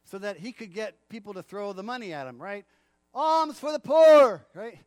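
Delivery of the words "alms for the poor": very loud, slow beggar's cry; beggar's call. Retold or imitated. Blind beggar calling for coins, slow and booming. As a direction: bellow it across the street. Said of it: "built up his vocal cords".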